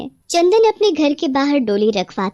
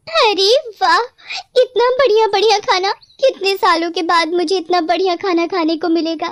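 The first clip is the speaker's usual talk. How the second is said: greedy delight; 8 semitones higher than usual. Acted. Possessed bride sees the feast, squealing with greedy delight. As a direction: gasp with hungry glee.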